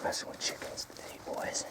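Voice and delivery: hushed whisper so the chickens stay calm. Real, not acted. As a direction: breathe it out, barely voiced.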